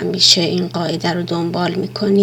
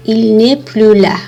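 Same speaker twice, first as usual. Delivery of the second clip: slow louder example; 2 semitones higher, 6 dB louder, much slower than usual. Shown as a demonstration. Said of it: "مثالهایی رو هم من برای شما ذکر کردم".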